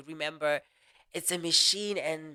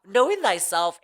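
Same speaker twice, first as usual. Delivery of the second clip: normal talking voice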